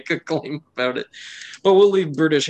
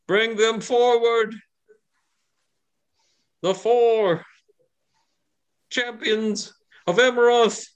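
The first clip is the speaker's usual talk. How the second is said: high, slow commanding shout; yelling command; 10 semitones higher, slower than usual. Acted. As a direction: call it out to the crowd. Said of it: "he yells out and he says".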